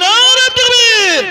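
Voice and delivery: very loud, high, drawn-out cry; forceful slogan shout. Real, not acted. Preacher demanding a stronger takbir from the crowd, bellowing. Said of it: "জোর দিয়ে বলি".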